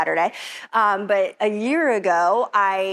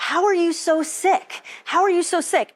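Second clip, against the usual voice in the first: retold doctor, pitched higher in baffled disbelief; doctor's disbelief; 7 semitones higher than usual. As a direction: ask it as a stunned doctor, voice raised high. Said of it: "being like".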